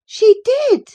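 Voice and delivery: put-on very high, loud girl's cry. A narrator's reading. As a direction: insist at the top of your voice. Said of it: "cried my young lady".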